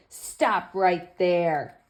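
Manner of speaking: slow, drawn-out command to halt; stern stop. Acted. George barring the door, slow and firm. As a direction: hold each word firm.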